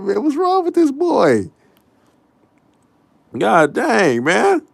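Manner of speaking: high, wailing disbelief; squeaky incredulous complaint; baffled exasperation. Retold or imitated. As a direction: stretch it up high, utterly baffled. Baffled outburst about the crying.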